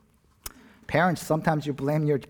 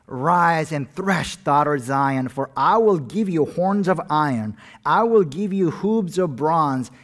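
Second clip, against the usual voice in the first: preaching scripture; 5 dB louder than usual. Acted. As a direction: thunder the prophet's words out. Pastor reads scripture aloud.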